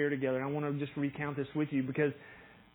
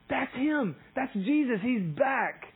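high, thrilled exclamation; excited recognition; 8 semitones higher, 3 dB louder, much slower than usual. Acted. Disciples spotting Jesus on the shore, excited high cry. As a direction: act out overjoyed disbelief, pitched well up.

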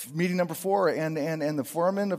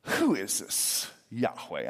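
smug Pharaoh; 7 semitones lower, 3 dB quieter, slower than usual. Acted. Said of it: "pompous sense of smug arrogance".

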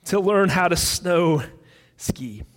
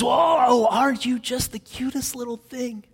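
lightly voiced lady fawning over a pet, trailing off softer; cooing lady; 2 semitones higher, 5 dB quieter than usual. Lady walks up to the parrot's cage, lightly voiced cooing. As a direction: coo sweetly at a cute little creature.

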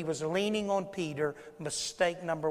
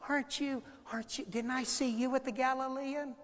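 put-on higher, softer girlish questioning; young teenage girl; 6 semitones higher, 6 dB quieter than usual. Maiden girl questioning Peter by the fire, high and soft. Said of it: "a little young teenage girl".

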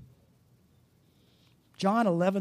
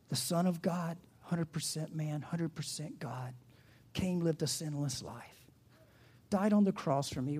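reverent hush; 4 dB quieter than usual. Real, not acted. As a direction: list it with quiet awe. Reverent hushed creed.

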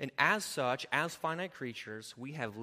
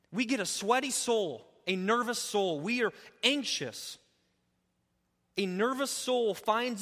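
loud, higher, driving sermon voice; fired-up pastor in full swing; full-force preaching; 9 semitones higher, 8 dB louder than usual. Acted. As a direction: preach it out to the back row.

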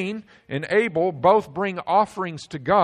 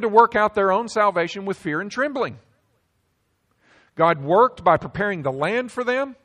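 louder and higher, a building exhortation that drives the point home; preaching at full force; 5 semitones higher, 4 dB louder than usual. Acted. Pastor urging his church to join God's work, full-force preaching. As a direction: preach it hard, pressing the people to act.

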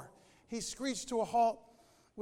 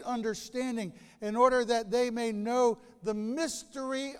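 louder, fervent Bible reading; preacher reading Colossians to the congregation, louder and fervent; scripture reading; 5 dB louder than usual. Acted. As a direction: proclaim the text like a preacher. Reads scripture aloud.

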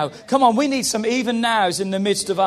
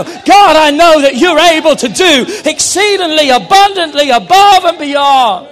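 loud, raised preacher's cry, building to a shout; crying out to God; 5 semitones higher, 11 dB louder than usual. Acted. Believer calling on God amid frustration, crying out. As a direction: cry it out at full force, stretching the final words. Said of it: "start crying out to a God".

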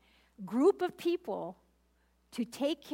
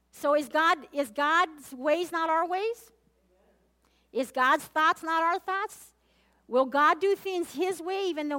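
rising preacher emphasis; 4 semitones higher, 6 dB louder than usual. Acted. Pastor preaching to congregation.

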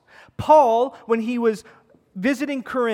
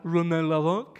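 hammed-up French accent; bad French accent; 4 semitones lower than usual. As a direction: attempt a French accent, badly, with a rising end. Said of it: "I can't even do a French accent".

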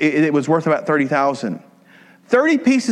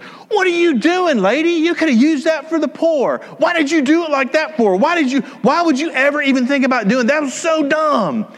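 high, loud mocking disciples; escalating comedy rant; 13 semitones higher, 3 dB louder than usual. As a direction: squawk it like indignant disciples. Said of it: "and all the disciples went".

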